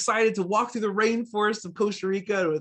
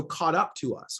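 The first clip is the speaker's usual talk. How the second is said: ordinary talk, nothing marked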